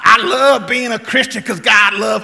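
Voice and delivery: loud, shouting parody of hype preaching; mock hype preaching. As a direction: ham up the catchy feel-good sermon.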